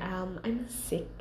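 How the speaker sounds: put-on feeble sick child. Daughter telling her parents she is ill, quiet and feeble. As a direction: say it small and unwell.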